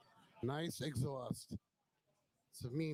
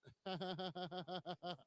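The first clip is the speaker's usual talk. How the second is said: long run of quick ha-has; rapid laugh; 2 semitones higher than usual. Real, not acted. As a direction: laugh in a quick, even rattle. Amused by the owner's helmet.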